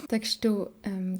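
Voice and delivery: ordinary delivery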